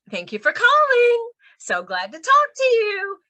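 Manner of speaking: high, lilting "thank you for calling"; musical sing-song. Shown as a demonstration. Trainer showing musical cadence, high sing-song "calling". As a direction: swing "calling" and "you" upward, bright receptionist lilt. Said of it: "little musical qualities".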